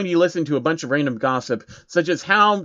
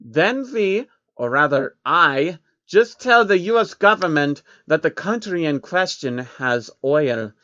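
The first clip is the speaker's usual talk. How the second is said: Rothschild voice; 3 semitones higher, slower than usual. Acted. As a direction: boast like a grand, smug banker villain. Rothschild character voice.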